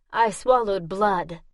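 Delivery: ordinary delivery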